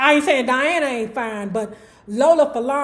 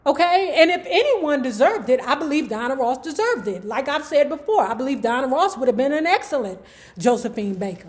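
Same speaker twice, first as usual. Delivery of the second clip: ordinary delivery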